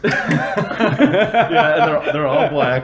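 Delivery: laughing. Real, not acted. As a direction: laugh out the words. Laughing at friend's joke.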